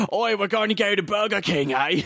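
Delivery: high, badly faked Australian accent; a hammed-up Aussie pirate; mock Australian pirate. An Australian pirate heading to Burger King, in a mock Australian accent. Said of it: "Australian pirates".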